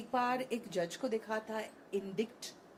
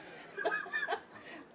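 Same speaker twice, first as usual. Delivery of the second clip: हँसी. Real, not acted. सवाल दोहराकर हँसी.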